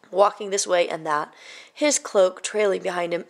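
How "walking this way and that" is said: ordinary delivery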